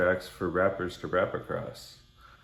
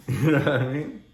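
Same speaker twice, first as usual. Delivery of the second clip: laughing; 7 semitones higher, 6 dB louder than usual. Real, not acted. Laughing at own tape joke.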